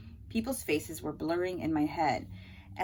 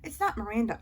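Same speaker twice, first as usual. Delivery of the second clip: ordinary delivery, nothing marked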